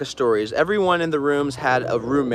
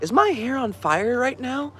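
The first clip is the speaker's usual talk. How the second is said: higher, slow, bewildered question; confused old lady; 10 semitones higher than usual. Retold or imitated. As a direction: ask it slowly, lost and worried. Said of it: "she looked at me and she's like".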